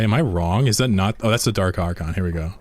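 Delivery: ordinary delivery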